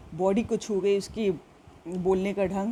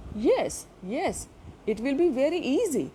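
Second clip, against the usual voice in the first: reassuring teacher; 5 semitones higher, much slower than usual. Acted. Teacher reassures shy girl.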